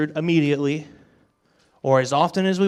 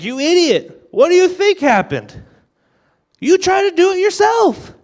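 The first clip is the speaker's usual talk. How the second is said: much higher and louder, scolding God impression; mock angry God; 8 dB louder than usual. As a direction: play God snapping at a foolish child, hammed up. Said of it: "He doesn't actually talk like this".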